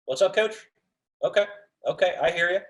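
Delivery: easy and receptive. Shown as a demonstration. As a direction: open up to the coach, easygoing. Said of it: "all we had to do was just".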